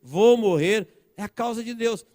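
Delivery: ringing pulpit declaration; impassioned preaching. Real, not acted. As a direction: preach it out to the congregation. Preaches at full force.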